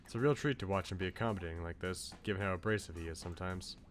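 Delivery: ordinary delivery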